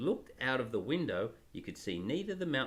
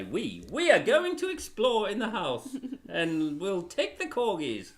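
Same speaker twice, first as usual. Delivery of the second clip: louder, higher regal proclamation; royal decree; 5 semitones higher, 3 dB louder than usual. Acted. Father voicing Peter as a royal decreeing explorations. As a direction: announce it like the Queen with corgis. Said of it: "another decree from Peter".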